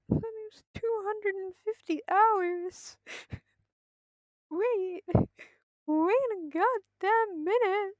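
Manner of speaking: high, loud, drawn-out disbelief; rising to a loud, high outcry; incredulous shock. Real, not acted. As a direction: stretch the words out in stunned, laughing disbelief. Shocked by the calculated playtime.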